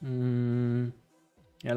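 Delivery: ordinary talk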